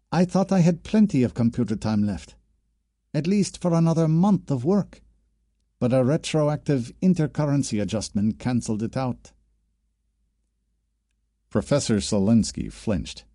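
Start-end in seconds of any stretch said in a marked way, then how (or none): 0.1-9.3 lightly raised student voice; a worried student explaining; young woman voice. Acted. Jacqueline voiced in dialogue.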